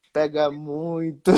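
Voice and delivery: slow, deliberate mock retort; drawn-out comeback. Goalkeeper answers the drunk heckler after saving, slow and deliberate. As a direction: act out a comeback, stretching each word.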